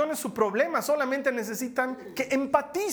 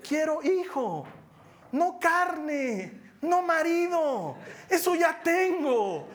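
high, slow, broken pleading; a mock-tearful woman begging for a child; imitating Ana; 7 semitones higher, much slower than usual. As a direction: play the weeping wife in halting words. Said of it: "yo me imagino que le ha dicho".

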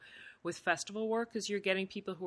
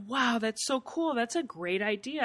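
excited encouragement; 6 semitones higher than usual. Retold or imitated. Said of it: "I'm like".

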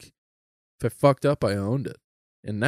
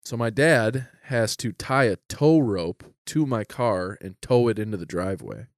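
ordinary delivery

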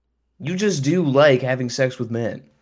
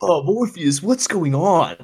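higher-pitched mock bewilderment; comic higher voice, asking what is happening; bewildered Neo act; 5 semitones higher, slower than usual. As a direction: play a hammed-up, confused Neo, pitched up. Plays bewildered Neo asking Morpheus.

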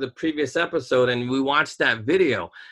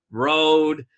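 ordinary talk

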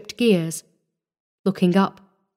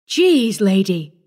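babbling apology; 3 dB louder, slower than usual. A narrator's reading. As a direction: blurt it out to the lady. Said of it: "the truck driver babbled an incoherent apology".

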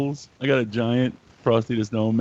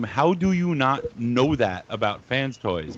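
usual speaking voice

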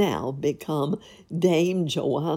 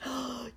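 soft gasp; 8 semitones higher, 11 dB quieter than usual. Acted. Gasp before Pedro's rage.

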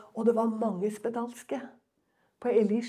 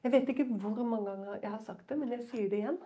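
usual speaking voice

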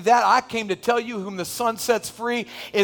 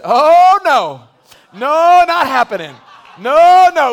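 loud laughing no, no; Berto refusing; 4 semitones higher, 9 dB louder, slower than usual. Retold or imitated. Berto turning down a woman, laughing loud refusal. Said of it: "Berto said".

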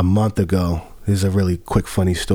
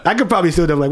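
ordinary talk, nothing marked

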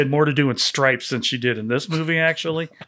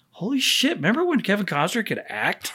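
amazed; 4 semitones higher, 6 dB quieter than usual. Retold or imitated. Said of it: "I'm like".

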